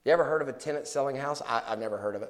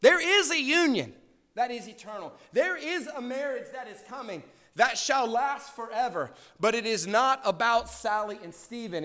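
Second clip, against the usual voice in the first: preaching at full force; 9 semitones higher, slower than usual. Acted. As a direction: proclaim it to the whole congregation. Preaching on eternal marriage.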